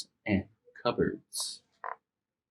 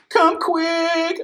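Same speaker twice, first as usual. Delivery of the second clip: put-on scared boy calling his mom; crying out. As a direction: call out in fright. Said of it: "he cried".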